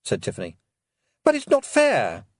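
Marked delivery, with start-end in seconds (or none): 1.2-2.3 put-on high, indignant boyish voice; a nervous young nobleman protesting; young Roland voice. A narrator's reading.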